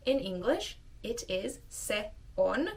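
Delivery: usual speaking voice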